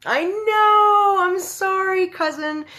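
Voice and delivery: squeaky high, loud sorry; high excited apology. Real, not acted. Apologising to her cousin for starting early, high and gushing.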